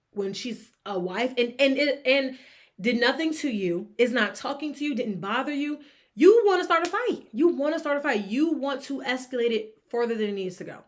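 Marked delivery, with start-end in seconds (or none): 6.1-7.3 much higher and louder, calling her out; indignant. Real, not acted. Scolding the mother who picked a fight, indignant and loud. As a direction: say it like an accusation.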